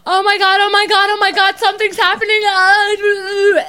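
high-pitched, loud panicked wailing; frantic mock cry as panic hits; panicked freak-out. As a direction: re-enact a freak-out, voice shooting up high. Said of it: "I go like this".

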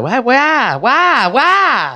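high, drawn-out mock wail; mock whining crowd. Host mimicking people back home, mock wail. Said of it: "People are just like".

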